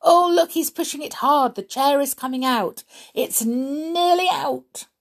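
high, loud, thrilled cheering; excited cheering. Acted. Binky cheers the sweep pushing the chair out, high and thrilled. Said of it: "dancing about excitedly".

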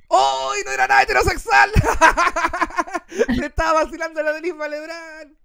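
high, loud laughing reveal; shrieking with laughter; laughing outburst. Real, not acted. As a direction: burst out laughing at the twist. Punchline reveal.

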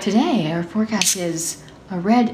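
lightly done TV weather voice; weather forecaster. Pretend. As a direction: read it like the Weather Channel. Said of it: "like, the Weather Channel".

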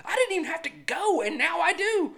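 high, indignant mock rant; mock outrage. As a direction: squeal it like you've been tricked. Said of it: "And it's like".

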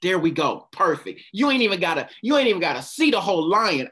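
normal talking voice

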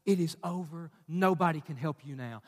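low, quiet doom; anxiety's voice. Acted. Preacher voices anxiety to the congregation, low and quiet. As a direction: sink the voice down and say it softly. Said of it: "Isn't that what anxiety tells you".

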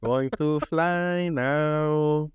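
very slow, dragged-out words; super slow sleepy sloth. Pretend. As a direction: stretch every word like a sleepy sloth. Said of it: "You sound like Sloth from Goonies".

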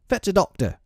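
put-on high boyish command; crying out. A narrator's reading. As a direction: order it urgently in a high voice. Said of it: "he cried".

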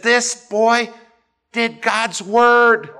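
high, loud, last words drawn out; acted fervent outrage. Preacher quoting his pastor defending Bible boy, fervent outrage. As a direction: cry out for the slighted boy.

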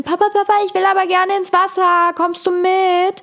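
little son whining to his dad to go swimming, pitched high; whining child. Retold or imitated. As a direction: whine up high like a small kid. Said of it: "anfängt zu quengeln".

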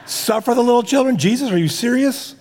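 high incredulous mock outrage; comic disbelief at old wording. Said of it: "incredulous mock reaction".

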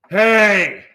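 very loud, high hey; full-volume shouted hey; retold yell back. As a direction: holler it back aggressively. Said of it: "I just fucking yell "hey" back".

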